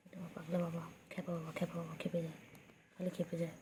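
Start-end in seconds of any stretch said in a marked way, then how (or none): none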